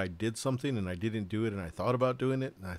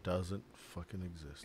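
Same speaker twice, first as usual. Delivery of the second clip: whispering; 3 semitones lower, 8 dB quieter than usual. Real, not acted. Muttered aside.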